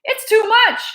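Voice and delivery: high, wailing lament; grieving cook. Acted. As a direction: mourn the old man aloud. Cook grieving over Gregory.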